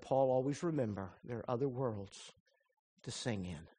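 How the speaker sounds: hushed last words of a friend. Retold or imitated. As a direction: let the note fade into a hush. Reading dead operator's note.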